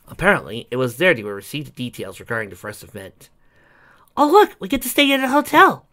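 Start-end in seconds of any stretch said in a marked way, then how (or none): 4.2-5.9 high, bright eager exclamation; excited. A narrator's reading. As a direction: burst out with delight. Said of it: "said Twilight excitedly".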